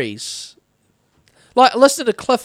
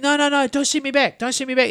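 higher, urgent mock plea; retold pleading; 5 semitones higher, faster than usual. Man begging the entity not to return him, pleading. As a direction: lightly act out begging to stay.

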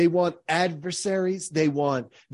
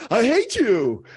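laughing through the words; mismatched laughing I hate you; laughing demo; 6 semitones higher, 6 dB louder than usual. Shown as a demonstration. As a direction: say it while laughing, so it clashes. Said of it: "that doesn't line up".

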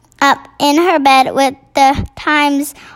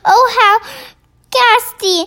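appalled, high-pitched outcry; horrified cry; 9 semitones higher than usual. A narrator's reading. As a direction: cry out aghast. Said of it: "the famous voice cried out".

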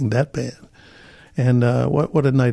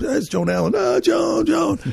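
introducing Joan; slower than usual. Retold or imitated. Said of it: "I introduce".

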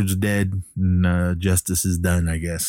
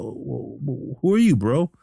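retold stammering question; 6 semitones higher than usual. Billy before Jake leaves, imitated stammering puzzlement. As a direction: stumble into a bewildered question.

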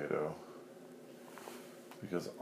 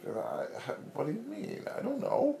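confused potato. Acted. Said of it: "'cause he's like".

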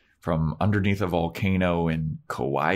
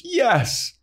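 squeaky high yes; high Buffy voice. Retold or imitated. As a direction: pitch it way up like a sulky teen. Buffy answer in retold quarrel.

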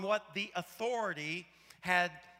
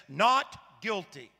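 slow, louder, authoritative ruling; judge's verdict; 6 dB louder, slower than usual. Retold or imitated. Judge bangs gavel and declares the verdict, slow authoritative. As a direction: pronounce the verdict with weight.

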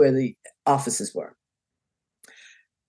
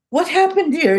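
startled surprise; 10 semitones higher than usual. Retold or imitated. Crowd bursts through the doors.

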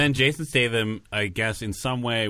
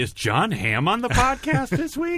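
very high, questioning voice; mock bewilderment, pitched way up; confused listener; 13 semitones higher than usual. Listener asking about the podcast guest, high and puzzled. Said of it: "I'm sure our listeners are like".

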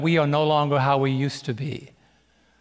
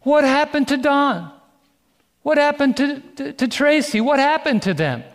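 astonished; 11 semitones higher, 3 dB louder than usual. Retold or imitated. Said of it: "People say".

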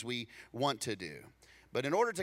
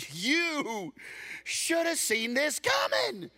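much higher, loud, slow shout; scolding shout; 12 semitones higher, 4 dB louder, much slower than usual. Retold or imitated. People shouting blame at a friend who failed. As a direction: hammer the blame home.

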